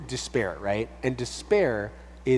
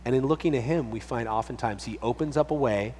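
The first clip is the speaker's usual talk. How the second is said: ordinary talk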